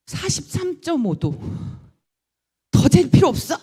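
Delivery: a shocked nurse shouting the number; nurse's announcement. Retold or imitated. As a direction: blurt out the reading in alarm. Nurse announcing fatal temperature.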